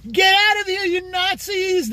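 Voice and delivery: high, drawn-out imitation of a would-be tough shout; mocking feminine voice. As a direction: make fun of someone trying to sound strong. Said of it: "that little feminine-ass voice trying to be strong".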